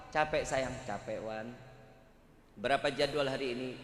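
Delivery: husband home tired to his wife, weary and soft. Retold or imitated. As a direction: sound worn out after work.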